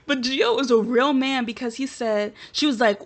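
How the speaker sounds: ordinary talk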